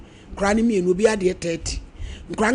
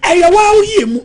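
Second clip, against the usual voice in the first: sudden loud outburst; 10 semitones higher, 10 dB louder than usual. Real, not acted. Heated family talk about money.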